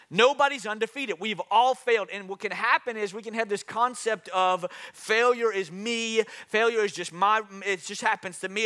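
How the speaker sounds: high, loud preaching, driving each point home; rally the congregation like a fired-up pastor; preaching at full force. Acted. Pastor to congregation about failure, preaching high and loud.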